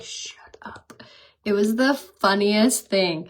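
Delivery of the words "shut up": put-on hissed whisper of a mortified boy; embarrassed whisper. Son mortified by mom's teasing, whispered. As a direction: whisper it, cringing. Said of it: "he was so like".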